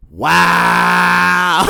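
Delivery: loud, very drawn-out mock wow; mock outrage. Recap of Maya answering her brother, mock outrage. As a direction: hold the word long in exaggerated offence.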